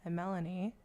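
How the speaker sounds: normal talking voice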